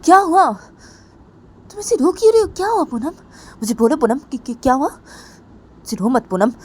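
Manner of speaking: high, loud worried questioning; worried friend. Acted. Pooja anxiously asking crying Poonam what happened. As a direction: press her friend anxiously for answers. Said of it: "Poonam को बोलती कि".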